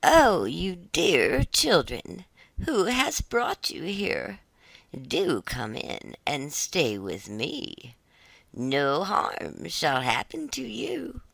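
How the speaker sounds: put-on slow, sugary old crone voice; falsely kind old woman, very slow; sweet old witch. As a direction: lure the children in slowly and sweetly. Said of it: "nodded her head and said".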